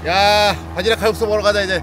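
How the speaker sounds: high, loud and thrilled; excited shout. Real, not acted. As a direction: call out to the group, fired up. Excited after catching clams. (신나서 외치듯)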